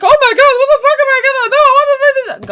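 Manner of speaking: mock panicked shriek, very high and loud; hammed-up screaming meltdown; freaking out. Dad after the car breaks down, shrieking in mock panic. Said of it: "My dad's freaking out".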